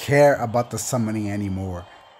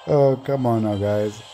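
ordinary talk, nothing marked

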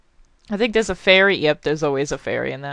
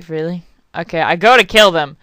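usual speaking voice

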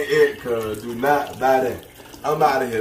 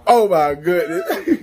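laughing disbelief; 4 semitones higher, 4 dB louder, slower than usual. Real, not acted. Smelling the spray.

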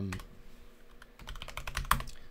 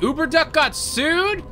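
surprised; 14 semitones higher, 4 dB louder than usual. Real, not acted. Learns game company got sued.

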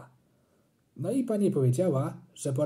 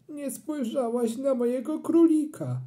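put-on a touch of a sniffly, higher boy; mild sob; 10 semitones higher than usual. A narrator's reading. Boy whimpering to mum about his rabbit, lightly sobbing. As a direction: whimper the complaint. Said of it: "chlipnąłem".

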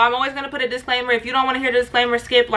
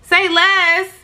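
higher, louder disbelief; incredulous; 6 semitones higher, 4 dB louder than usual. Real, not acted. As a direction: throw his words back, amused. Her reaction repeating his words.

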